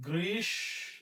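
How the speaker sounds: গ্রীষ্ম as "greesh", pause, "sho"; doubled sh, silent m. Shown as a demonstration. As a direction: hold and separate the sh sounds of গ্রীষ্ম. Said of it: "তাহলে কি হবে".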